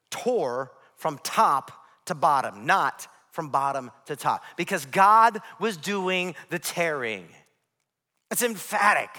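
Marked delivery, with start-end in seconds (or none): none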